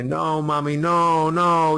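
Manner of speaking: put-on high, loud begging little child; pleading child. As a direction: beg mommy not to leave, desperate. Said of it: "they're begging, they're pleading".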